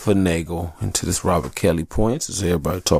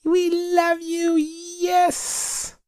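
screaming fans; much slower than usual. Retold or imitated. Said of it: "people screaming like".

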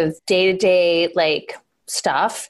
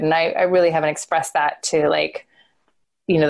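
ordinary delivery

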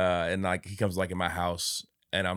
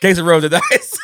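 laughing; 10 dB louder than usual. Retold or imitated. Mock snitch quote with laugh.

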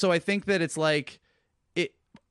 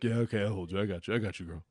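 put-on low, soft dopey boyfriend voice; dopey cartoon bellow; 6 semitones lower, 5 dB quieter, faster than usual. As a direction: drop low and soothe like a lug. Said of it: "And he's like".

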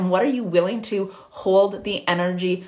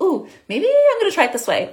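much higher, louder, dazzled by a shiny object; mock excited gush; 11 semitones higher, 5 dB louder than usual. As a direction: gush over a new shiny idea, hammed-up. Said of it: "we're like".

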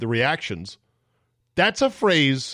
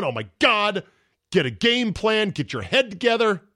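exasperated; 7 semitones higher than usual. Real, not acted. Exasperated at gambler.

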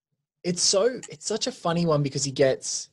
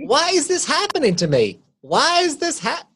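Nicholas as played by Eddie Marsan; 3 dB louder than usual. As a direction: wail it in a put-on, much higher voice. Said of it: "Nicholas Eddie Marsan".

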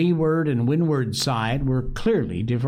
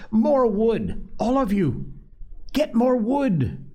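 shouting; 11 semitones higher than usual. A narrator's reading. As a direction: yell for more wood, commanding the boys. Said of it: "Ralph shouted".

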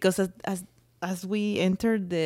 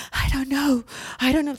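imitating a flustered husband, higher and louder; mock stressed panic; 6 semitones higher, 3 dB louder than usual. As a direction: play up his panic as if he is lost for an answer. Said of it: "He get very stressed about almost everything".

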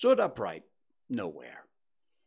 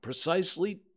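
ordinary delivery, nothing marked

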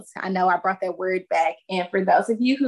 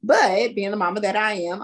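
usual speaking voice

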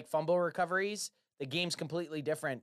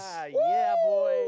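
high, loud whooping; excited whoop; 6 dB louder, much slower than usual. Real, not acted. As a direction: cheer like the game's just won. Celebration whoops.

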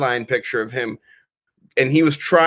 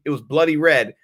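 normal talking voice

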